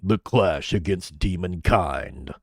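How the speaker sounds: deep menacing voice. Shown as a demonstration. Streamer showing the menacing deep read. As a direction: growl it with depth. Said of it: "grit and depth and bass".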